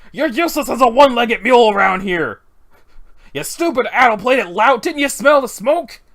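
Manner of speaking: loud, high, furious bawling; angry scolding. Acted. Scolding someone who didn't smell the smoke, furious shout. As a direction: holler in outrage at a useless lout. Acted scolding after a fire.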